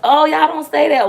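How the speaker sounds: high, loudish astonishment; mock surprise. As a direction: react as if amazed by the news. Mimics surprise at regional word.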